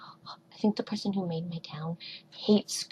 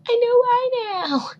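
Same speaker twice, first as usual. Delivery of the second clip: higher, louder fake wail; mock crying; 13 semitones higher, 8 dB louder than usual. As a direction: cry it out in jest. Mock crying about scooter.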